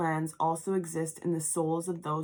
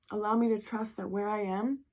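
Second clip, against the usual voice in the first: ordinary delivery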